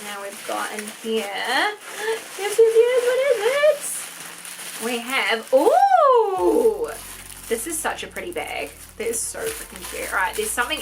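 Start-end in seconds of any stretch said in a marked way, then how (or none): 1.8-2.3 high, rising gasp of excitement. Real, not acted. Discovering another item. 2.4-3.9 very high, loud delighted squealing; excited squeal. Real, not acted. Squealing at another surprise item. 5.5-6.9 loud, high, long swooping ooh; delighted ooh. Real, not acted. Revealing the pretty bag.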